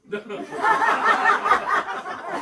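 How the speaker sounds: very high laughing; mock patient laugh. Patient laughing at the doctor, high laugh. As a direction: do her laugh.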